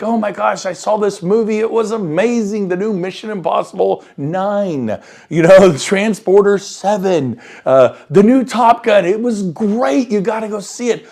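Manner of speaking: hammed-up thrilled recommendation; over-the-top excitement. Shown as a demonstration. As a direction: coach demoing raw enthusiasm. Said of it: "I'm maybe over-exaggerating a few things".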